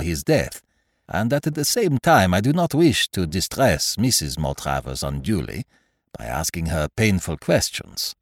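put-on methodical detective in a French-style accent; Poirot, foreign-accented detective. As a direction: reason it out aloud with a foreign accent. Voices Poirot in dialogue.

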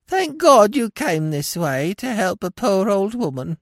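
high, whining sing-song crone; whining old woman. A narrator's reading. Feigned old woman begs the passing gentleman, whining. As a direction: wheedle like a feeble old beggar. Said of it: "she whined in a sing-song voice".

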